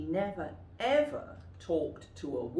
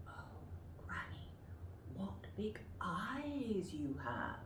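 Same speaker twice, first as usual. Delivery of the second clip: whispered; 11 dB quieter than usual. Shown as a demonstration. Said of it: "practice them yourself".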